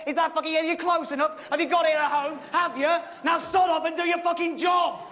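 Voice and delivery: exasperated sarcastic ranting. Acted. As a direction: berate the cameraman in a heated shout. Ranting at the cameraman.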